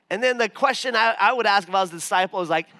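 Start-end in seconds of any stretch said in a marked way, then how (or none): none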